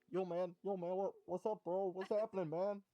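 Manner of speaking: loud high bro voice; street dude voice. Acted. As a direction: greet like a cocky street guy. Lobster greets in street slang.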